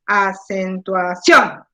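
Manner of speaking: "acentuación" syllable by syllable, CIÓN punched; exaggerated final stress. Shown as a demonstration. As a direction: stretch out "acentuación" slowly and hit CIÓN hard. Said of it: "yo haría así".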